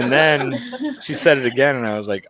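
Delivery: ordinary talk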